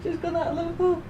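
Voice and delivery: high-pitched thrilled laughter and squeals; watching the airliner pass overhead, high giddy laughing delight. Real, not acted. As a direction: squeal with real joy, voice way up.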